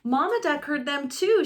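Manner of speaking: ordinary delivery, nothing marked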